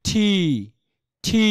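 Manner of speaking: ঠি with aspirated ঠ and held vowel; correct pronunciation. Shown as a demonstration. Teacher models the next word for students, aspirated ঠি. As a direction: say ঠি alone, puffed and drawn out. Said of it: "আর এখানে কি হবে?".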